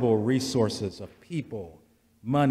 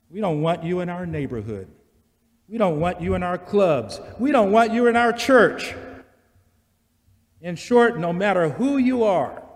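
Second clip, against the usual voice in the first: rising louder to a peak on church; building preaching; 6 semitones higher, 7 dB louder than usual. Acted. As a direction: swell into the climax. Preaching crescendo.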